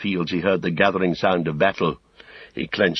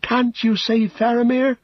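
high, urgent halfling voice; desperate plea; 4 dB louder than usual. Acted. Pippin begs Gandalf to save Faramir, pleading.

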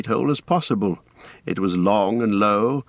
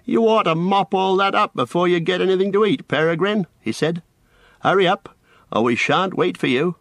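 lightly voiced, higher mock-stern scolding; a hint of a bossy older cousin; scolding Merry; 9 semitones higher than usual. A narrator's reading. Merry tells Pippin to mop the floor, higher mock scolding.

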